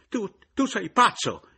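very high, loud stammered screech; railing. A narrator's reading. Mother-in-law rails at the returned man, screeching. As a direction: lash out undaunted, shrieking. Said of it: "inveì imperterrita colei".

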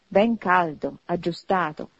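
ordinary delivery, nothing marked

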